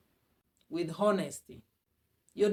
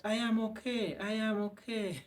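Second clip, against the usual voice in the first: a hint of someone faking fine, softer; mock pretend okay; 3 semitones higher, 3 dB quieter than usual. Mocking people who pretend to be okay, lightly faked. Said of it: "when people pretend that".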